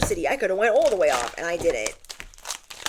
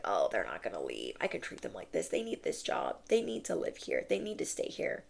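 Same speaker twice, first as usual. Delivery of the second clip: much lower and much softer, a hushed smug muttering; sneering whispered inner voice; 12 semitones lower, 12 dB quieter than usual. Retold or imitated. Voicing the people who think you'll never leave, in a low smug whisper. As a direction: hiss it quietly like a gloating schemer.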